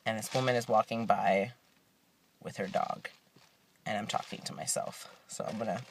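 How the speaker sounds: hushed, nearly voiceless aside; whispering. Real, not acted. Vlogger in the car as a woman walks by, whispering. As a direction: drop to a low whisper. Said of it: "I don't know why I'm whispering".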